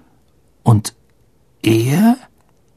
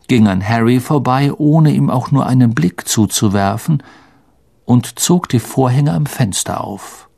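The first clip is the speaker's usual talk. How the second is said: ordinary delivery, nothing marked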